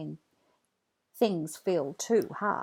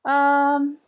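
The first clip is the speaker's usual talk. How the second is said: hesitant; 7 semitones higher, 7 dB louder than usual. Acted. Hesitating over price.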